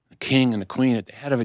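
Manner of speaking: ordinary delivery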